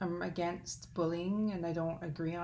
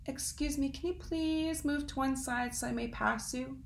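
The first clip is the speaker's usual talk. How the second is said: polite wren; 8 semitones higher than usual. Acted. Wren asks again.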